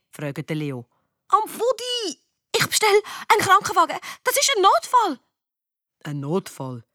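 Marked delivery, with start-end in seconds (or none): 1.3-2.2 high, pained character voice; pained cry. Acted. Felix hurt, asked where. 2.6-5.2 high-pitched, urgent alarm. Acted. Alarm over injured friend, calls ambulance. 6.0-6.8 low, unimpressed questioning; sceptical echo. Acted. Doubting echo of the alarm.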